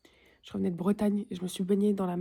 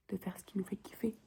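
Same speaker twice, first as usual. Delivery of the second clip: whispering; 9 dB quieter than usual. Real, not acted. Whispered motto.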